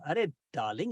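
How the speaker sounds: usual speaking voice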